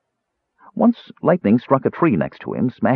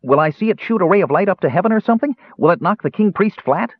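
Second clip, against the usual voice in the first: high, fast, bubbling kender voice; a young kender rattling off questions at speed; eager kender pesters the mage, high and fast; excited kender; 11 semitones higher, faster than usual. A narrator's reading.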